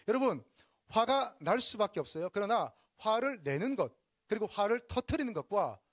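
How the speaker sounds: impassioned sermon voice; forceful preaching. Real, not acted. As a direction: hammer it home from the pulpit.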